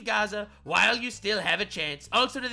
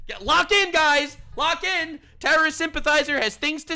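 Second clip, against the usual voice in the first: hype shout; 7 semitones higher, 6 dB louder than usual. Real, not acted. Hyping chat to focus.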